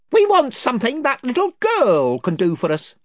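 invisible chief. Acted. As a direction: boast of his band's numbers.

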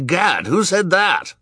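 very high, drawn-out astonishment; startled exclamation. A narrator's reading. Banker demanding who spoke, high startled voice. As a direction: exclaim it, pitch shooting up. Mister Poe startled by the accusation.

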